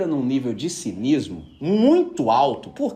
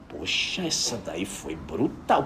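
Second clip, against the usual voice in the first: slow, lower, softer classmate voice; mock classmates' awe; 10 semitones lower, 6 dB quieter, slower than usual. Classmates reacting to the rebuke, slow and hushed. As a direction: act out the stunned classmates.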